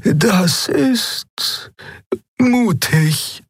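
very high, hesitant with a pause; high voice, pausing before the praise; Xea's character voice. Acted. Voices Xea reacting to plan. (hohe, zögernde Figurenstimme)

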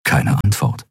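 ordinary delivery

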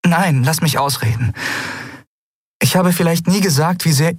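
high-pitched woman, determined to finish; insistent woman. Acted.

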